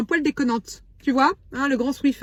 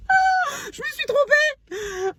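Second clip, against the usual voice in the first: high dismayed wail; 13 semitones higher, much slower than usual. Acted. Realizes mistake.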